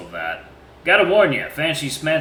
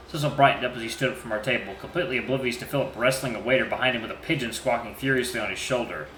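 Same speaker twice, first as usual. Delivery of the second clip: normal talking voice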